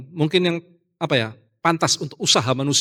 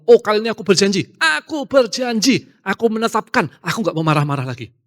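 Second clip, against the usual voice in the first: mock solemn promise, pitched higher; earnest vow; 6 semitones higher than usual. Pastor mimicking a believer's vow, mock earnest. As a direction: ham up a pious resolution, slowing on "aku berjanji". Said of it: "pernah nggak kita ngomong".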